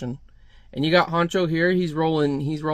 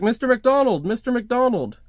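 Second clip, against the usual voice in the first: calling out; 8 semitones higher than usual. Acted. Calling after someone who doesn't hear.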